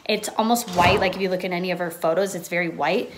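ordinary talk

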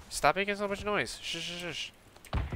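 hushed warning to a noisy friend; urgent hush. Real, not acted. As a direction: keep him quiet so they're not heard. Hushing friend, hiding from strangers.

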